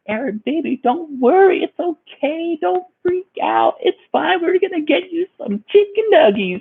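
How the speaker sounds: high, syrupy, sing-song coaxing; mock baby talk. Girlfriend soothing her boyfriend in the car, high mock baby talk. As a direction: coo at him like a fussy toddler. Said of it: "She's like".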